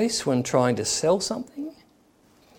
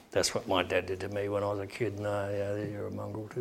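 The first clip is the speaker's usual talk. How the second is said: low, much softer grumble; muttered aside; 5 semitones lower, 13 dB quieter than usual. Acted. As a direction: mutter it under your breath, acting the resentful onlooker. Voicing someone identifying with boy.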